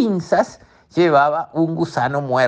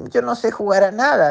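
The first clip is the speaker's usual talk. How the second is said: higher crab voice; 8 semitones higher than usual. Acted. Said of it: "dijo el cangrejo".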